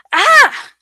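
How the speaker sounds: sudden high yelp of fright; short scream as she spins around; startled yell. A narrator's reading. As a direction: jump and cry out, startled. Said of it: "I yelled and spun around".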